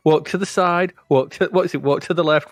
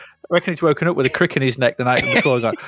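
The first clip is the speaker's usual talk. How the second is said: normal talking voice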